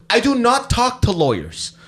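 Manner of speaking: high, emphatic refusal; mock-officious declaration; imitated indignant officer. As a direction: act out an official shutting someone down. Officer curtly refuses the lawyer call.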